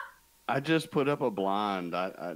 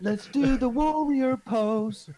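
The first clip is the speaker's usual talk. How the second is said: put-on high, slow old lady voice; mock grandma voice; 12 semitones higher, 6 dB louder, slower than usual. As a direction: play a silly yoga granny. Mock grandma yoga line.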